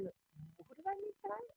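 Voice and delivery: soft, high, slow wondering; musing to oneself. Acted. Someone going through cards after a party, musing softly. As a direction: wonder quietly, drawing it out high.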